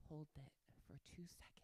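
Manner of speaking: unvoiced hushed instruction; soft whisper cue. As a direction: whisper the hold. Breathing exercise hold.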